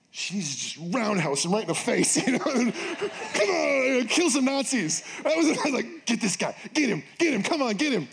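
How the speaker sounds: high, excited egging on; eager cheering. Retold or imitated. Pastor recalling his urge to see the guard hit, high and eager. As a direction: cheer for the punch.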